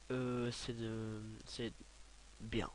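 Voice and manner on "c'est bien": ordinary talk, nothing marked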